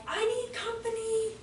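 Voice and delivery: high, slow, half-whimpering plea; mock a small boy asking sadly; a hint of a whining kid; pleading little boy.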